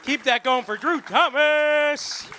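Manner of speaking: loud, high, drawn-out announcer call; host's hype shout. Real, not acted. Host to crowd as comic exits, rousing shout. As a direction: whip up the applause, stretching the name.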